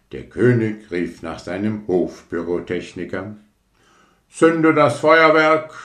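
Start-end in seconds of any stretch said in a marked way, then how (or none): 4.3-5.7 loud, high royal command; commanding king. Acted. King orders the fireworks lit, loud command. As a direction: bark the order across the garden. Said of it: "befahl der König".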